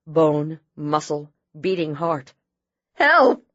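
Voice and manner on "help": high, loud desperate scream. A narrator's reading. Wife screams for help over her husband, very high and loud. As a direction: scream for help at full force. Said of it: "she screamed".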